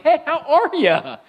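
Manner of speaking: sing-song put-on hello; fake cheery phone voice. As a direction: chirp it like nothing is wrong at home. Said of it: "someone calls on the phone like".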